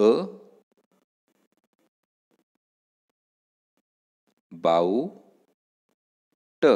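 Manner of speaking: अ, बाऊ, ट said as separate sounds with long pauses; sound-by-sound breakdown. Shown as a demonstration. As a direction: break "about" into अ ... बाऊ ... ट, each sound alone. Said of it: "त्याचं phonetic transcription असं होईल".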